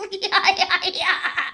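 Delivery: high laughing yeahs. Real, not acted. Gamer laughing after the round, high giggling.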